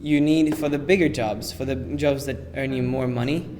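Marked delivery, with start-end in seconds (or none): none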